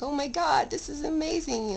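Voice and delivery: retold joy, pitched high; delighted. Recalling her baby son's birth, high delighted gush. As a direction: gush over the new baby. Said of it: "I was like".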